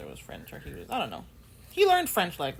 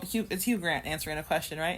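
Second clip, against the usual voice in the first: ordinary talk, nothing marked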